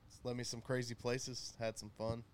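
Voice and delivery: normal talking voice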